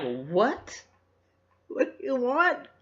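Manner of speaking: high, rising, panicked questions in imitation; mock freaked-out. Couple freaking out at the robber, mock panic. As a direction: play a startled hold-up victim for laughs. Frightened couple answering the hold-up.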